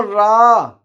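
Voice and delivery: रा with a long, held "aa"; drawn-out syllable. Shown as a demonstration. Teacher asking pupils to say it, रा drawn out. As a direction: stretch रा out slowly and firmly. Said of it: "या बोलो".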